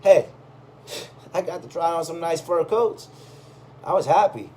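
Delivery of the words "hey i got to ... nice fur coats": ordinary delivery, nothing marked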